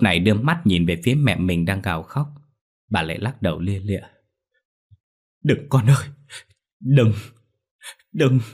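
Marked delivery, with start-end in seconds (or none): 5.4-8.4 desperate cry. Acted. Mother cries out to daughter.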